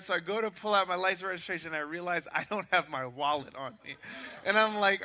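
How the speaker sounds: ordinary talk, nothing marked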